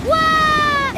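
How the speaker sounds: high, astonished whoop; amazed cry. Acted. Thrilled shout as the washing-machine engine starts. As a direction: gasp it out in wonder.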